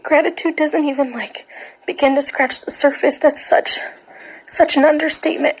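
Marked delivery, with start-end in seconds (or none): none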